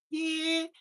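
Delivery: put-on squeaky little kid asking for breakfast; high kid voice. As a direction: pitch way up like a small child. Kid answering parent.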